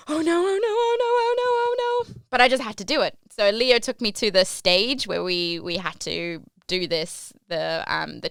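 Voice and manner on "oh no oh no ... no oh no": rising high, panicky whimper of repeated oh no; mock inner panic, rising very high. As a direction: climb higher with each oh no as dread builds. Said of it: "but inside I'm going".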